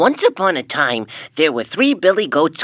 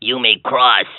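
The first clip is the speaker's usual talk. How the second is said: deep troll voice; 8 semitones lower than usual. A narrator's reading. Troll replies.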